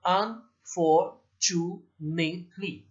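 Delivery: Unfortunately very slowly, a gap after each syllable. Shown as a demonstration. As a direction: break unfortunately into un, for, chu, nate, ly. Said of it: "एक word में जितने हैं उतने syllables".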